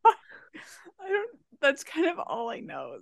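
high and giggling; struggling through laughter; trying not to laugh. Real, not acted. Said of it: "I know you're laughing, and it's not helping".

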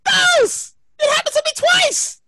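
high, squealing disbelief breaking into laughter; incredulous outcry. Real, not acted. Man caught in protests twice, high laughing disbelief. Incredulous at own bad luck.

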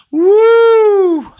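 long, high, loud whooping cry; ecstatic woo. Acted. Healer releasing the client's energy, whooping loud and high. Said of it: "whatever this is that you're working on".

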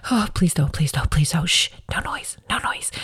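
hushed urgent whisper fading away; pleading whisper. Retold or imitated. Mom hushing her kids while recording, pleading whisper. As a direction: beg the kids for silence in a whisper. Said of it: "So it's like".

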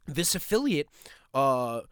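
normal talking voice

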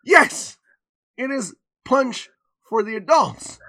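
loud, high, broken-up emphasis; mock stern mom. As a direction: imitate a mother spelling it out in separate bursts. Mom retorts to child.

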